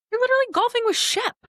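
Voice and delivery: high-pitched, astonished disbelief; incredulous reaction. Retold or imitated. Wife recalls reacting to husband's golf picture, incredulous. Retells her amazed reply.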